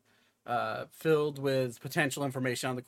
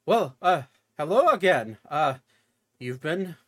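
jovial; 3 semitones higher, 5 dB louder than usual. Acted.

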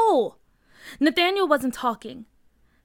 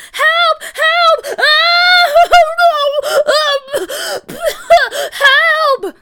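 very high, screaming panic; frantic, loud drowning screams; yelled cries for help; 12 dB louder, much slower than usual. A narrator's reading. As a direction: yell for help while floundering in water. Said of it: "Nathaniel yelled".

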